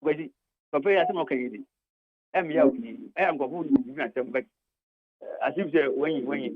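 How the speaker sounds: ordinary talk, nothing marked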